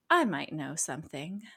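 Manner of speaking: a lightly voiced calm, even Fogg; low-key mysterious professor tone; a hint of the calm, robotic Fogg; Fogg impression. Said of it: "you do a great impression of Fogg, by the way, 'cause your voice changes".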